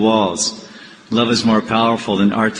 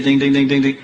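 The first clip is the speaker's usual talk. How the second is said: higher, evenly repeated bell imitation; imitated bell dinging; 4 semitones higher than usual. Pujari ringing the bell at Mangalarti, imitated dinging. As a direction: voice the little worship bell.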